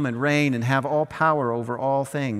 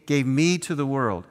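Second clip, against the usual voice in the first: normal talking voice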